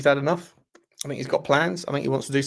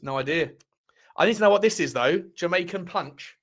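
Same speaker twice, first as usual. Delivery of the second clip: ordinary talk, nothing marked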